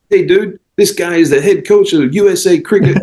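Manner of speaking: high, brash New Yorker hyping up the coach; brash American golf-club bloke, pitched high and buzzing; loud New Yorker. Retold or imitated. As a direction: play the excitable New Yorker who just found out who you are. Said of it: "they're not quiet".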